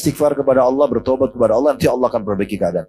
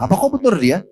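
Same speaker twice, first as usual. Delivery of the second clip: surprised Umar; 7 semitones higher than usual. A narrator's reading. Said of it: "Umar kaget".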